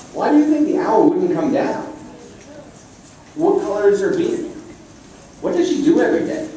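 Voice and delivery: the little bird curiously quizzing Rocket about the owl. Acted. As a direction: pepper him with bright bird questions. Bird asks curious questions.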